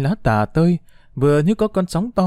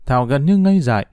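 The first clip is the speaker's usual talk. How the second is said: plain reading voice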